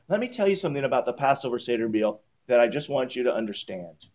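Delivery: hushed, quick confidential tone; quiet aside. Acted. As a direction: confide it to the room. Repeats it, confiding.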